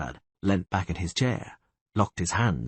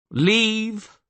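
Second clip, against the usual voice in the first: a curt castellan's command, pitched up; ordering the guards; 13 semitones higher, 4 dB louder than usual. A narrator's reading. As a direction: bark it at the guards, castellan in charge. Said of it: "he ordered the guards".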